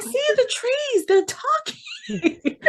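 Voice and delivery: put-on very high child voice, drawn out at the end; mock child squeal, tipping into laughter; imitated little girl. Daughter telling mother about the talking trees, in a mock child squeal. Voicing child Lydia in the story.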